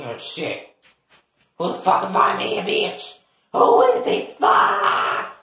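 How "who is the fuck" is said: shouting. Acted. Angry shout in a quarrel.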